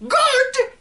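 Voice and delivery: very high, loud incredulous screech; old woman screaming. Acted. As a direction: scream the word in outrage. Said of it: "she screamed".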